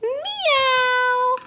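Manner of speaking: high, stretched kitty meow; cat meow. Pretend. Teacher pretending to be a cat, high meow. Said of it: "So I am a cat".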